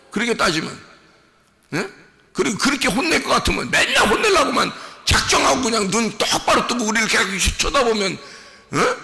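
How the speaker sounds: loud, high fervent sermon; rising revival preacher's cry; full-force preaching. Acted. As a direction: preach it to the back row. Preaching continues.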